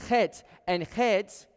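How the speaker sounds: "chet" with a throat-scraping Hebrew ch; throaty chet. Shown as a demonstration. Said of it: "you make the noise just like it sounds".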